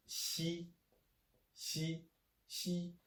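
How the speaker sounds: xi said high and level; soft hissing xi, held high; Chinese xi. Shown as a demonstration. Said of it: "its pronunciation is".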